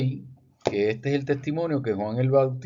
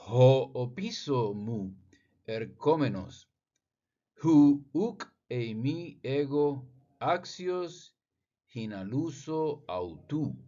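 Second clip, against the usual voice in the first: slow, deliberate reading of ancient Greek; 2 semitones higher, slower than usual. Shown as a demonstration. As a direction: sound out each Greek word carefully. Reads Greek text slowly.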